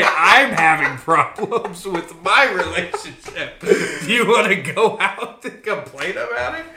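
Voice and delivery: slow, loud, laughing through the words. Retold or imitated. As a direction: fake sad pitch, breaking into laughs. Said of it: "I'd say".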